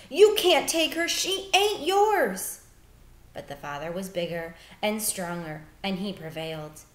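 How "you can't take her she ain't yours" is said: desperate scream. Acted. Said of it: "Bryce screamed".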